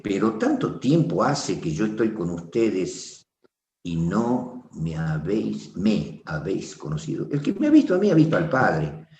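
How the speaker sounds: ordinary talk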